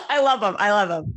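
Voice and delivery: amused delight. Real, not acted. Guest owning up to loving dad jokes, laughing. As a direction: laugh as you say it.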